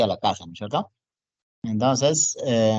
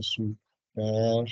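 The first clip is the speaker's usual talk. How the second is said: SUPERVISORA slowly broken into long syllables; syllable-by-syllable dictation; 2 semitones lower, 5 dB quieter, slower than usual. Real, not acted. Teacher writing Supervisora for students, drawn-out dictation. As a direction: spell out Supervisora by sound, slowly.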